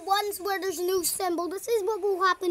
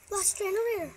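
ordinary talk, nothing marked

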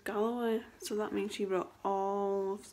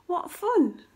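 high, loud storybook glee; giggly delight; 8 semitones higher, 8 dB louder than usual. A narrator's reading. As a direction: exclaim it like a delighted shark. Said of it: "giggled Smiley Shark".

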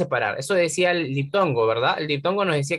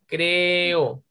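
"Creo" drawn out as cre-o; slow demonstrative split of "Creo"; separated vowels. Shown as a demonstration. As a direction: hold the E of "Creo", then let O fall. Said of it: "las separamos".

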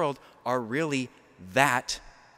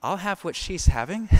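comic quip; 5 semitones higher than usual. Retold or imitated. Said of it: "I'm thinking".

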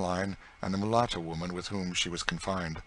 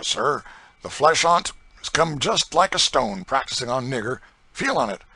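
loud, swaggering slave driver; coarse boasting planter; 5 semitones higher, 9 dB louder than usual. Acted. Planter bragging to a stranger about his system, loud and swaggering. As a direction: brag it out loud and hard. Legree showing off his fist.

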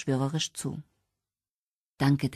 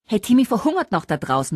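higher, louder call across the room; 7 semitones higher, 5 dB louder than usual. A narrator's reading. Kira to Richard about the boy outside, calling out. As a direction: call it out in protest. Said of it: "rief Kira Richard zu".

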